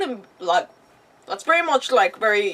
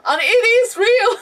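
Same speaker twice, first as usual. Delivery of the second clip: laughing, louder and higher, slower; laughing disbelief; 10 semitones higher, 6 dB louder than usual. Real, not acted.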